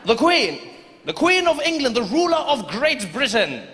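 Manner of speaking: high, slow pompous proclamation; pompous fussy courtier. Acted. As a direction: announce her like royalty. British soldier proclaiming.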